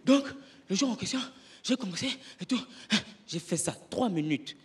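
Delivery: ordinary talk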